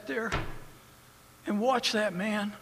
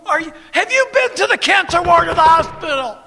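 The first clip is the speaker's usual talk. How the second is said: "have you been to the cancer ward" shouted high and loud; fiery challenge; 8 semitones higher, 10 dB louder than usual. Real, not acted. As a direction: hurl the question at the critics. Repeated challenge, louder.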